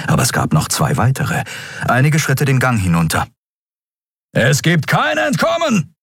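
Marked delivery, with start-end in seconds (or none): 4.3-5.9 booming villain threat, pitched much higher; scarred thug thundering a threat. A narrator's reading. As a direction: thunder it down the corridor. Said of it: "donnerte ein narbengesichtiger Mensch".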